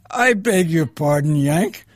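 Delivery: imitated offended outburst; mock indignation. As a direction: play the insulted Irish driver. Offended Irish driver challenged in retold story.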